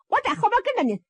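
high-pitched son with a thick tongue; high, eager lisped reply; tongue-tied son. A narrator's reading. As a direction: answer high, as the lisping youngster. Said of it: "盖黑杰说话也有毛病， 有时".

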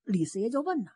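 plain reading voice

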